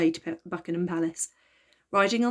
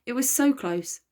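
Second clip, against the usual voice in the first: plain reading voice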